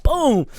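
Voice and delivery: high, punchy "boom" crash noise; imitated crash. Vlogger acting out car hitting biker, punchy boom. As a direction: make the crash sound with your mouth. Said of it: "and you're just like".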